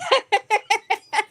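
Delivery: loud, very high cackling laugh; real burst of high laughter. Real, not acted. Cracking up at own story of the live video, laughing.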